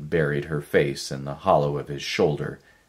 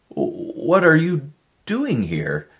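plain reading voice

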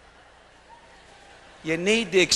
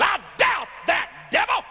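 high, ringing defiant shout; 12 semitones higher, 3 dB louder than usual. Acted. Believer telling the devil off, high defiant shout. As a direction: throw it back at the devil loud.

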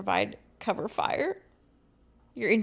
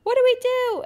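high, loud frightened yell; Emily yelling in fear; panicked yell; 10 dB louder than usual. Acted. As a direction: scream it in panic. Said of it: "Emily yells, her voice trembling".

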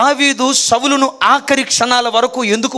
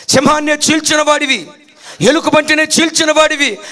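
higher, louder urging that builds to a shout; rousing urging; 7 semitones higher, 4 dB louder than usual. Acted. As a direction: egg him on, rising to a cry. Saul's men urging David to kill. (ఉత్సాహంగా ఎగదోస్తూ, చివరకు అరుపు)

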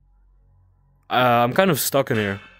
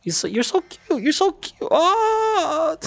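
much higher squeaky coo; slower than usual. Real, not acted. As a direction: coo at a tiny pet. Baby talk to the pet.

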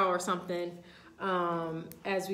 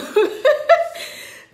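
high, loud peal of laughter; real helpless laughter; loud laughing fit; 13 dB louder than usual. Real, not acted. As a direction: break up laughing mid-ritual. Laughs while trying to resume ritual.

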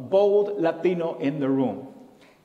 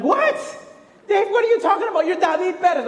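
loud, high, hammed-up disbelief; incredulous outburst; 14 semitones higher, 6 dB louder, faster than usual. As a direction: protest in shock, voice shooting up. Retold shocked professor objects to student.

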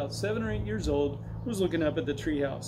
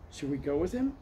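usual speaking voice

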